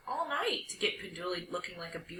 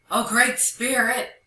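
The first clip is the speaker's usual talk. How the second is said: loud, drawn-out, raised-pitch wail; howling plea; 4 semitones higher, 7 dB louder than usual. Acted. Dog begging the great spirit, howling. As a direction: howl it out to a feared spirit. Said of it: "he howled".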